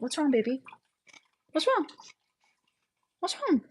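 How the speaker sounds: high, soft coo to a pet. Real, not acted. Streamer coos high and soft to her rabbit.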